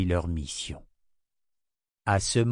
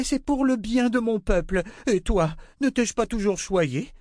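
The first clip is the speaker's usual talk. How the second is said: high, desperate queen's voice; pleading queen; 13 semitones higher than usual. A narrator's reading. Queen begging Rachel for help, desperate. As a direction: beg for help.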